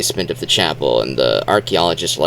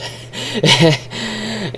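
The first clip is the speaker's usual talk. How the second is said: high laugh; 11 semitones higher than usual. Real, not acted. Laughs at the plot.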